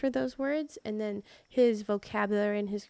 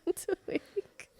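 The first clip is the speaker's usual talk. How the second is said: higher, drawn-out words through laughter; incredulous laughing; 7 semitones higher, slower than usual. Real, not acted. Wife echoing partner's offer to help, incredulous laughing. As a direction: laugh it out slowly in disbelief.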